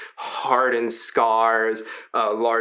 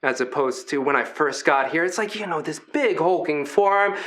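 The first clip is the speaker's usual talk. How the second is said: ordinary delivery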